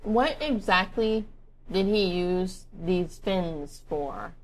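slowly and carefully, mouth now empty; slow clear repeat. Real, not acted. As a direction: spell it out word by word. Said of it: "let me finish".

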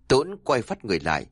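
plain reading voice

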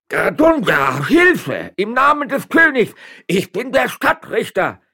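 high, loud cry for help; strained, panicked yell; desperate calling. A narrator's reading. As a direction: shout for rescue as if being trampled. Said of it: "rief schließlich Don Eugenio".

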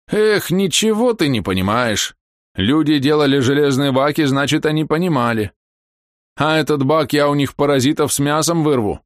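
put-on high, scornful old man; stubborn old man. Voicing Kalina Ivanovich.

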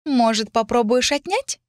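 high, slow taunting question; teasing dare. A narrator's reading. Lilith dares heroine to take book, teasing. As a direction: dare her in a slow singsong. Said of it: "продолжая дразнить меня".

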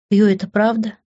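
ordinary delivery, nothing marked

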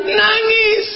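high, stretched mock wail; mock weeping. Mimicking the wife's crying. (pura-pura meratap dengan nada tinggi)

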